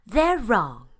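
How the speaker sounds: high, loud firm duck; quacked reply. A narrator's reading. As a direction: stand up for her friend with a quack. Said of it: "quacked Mary Ann".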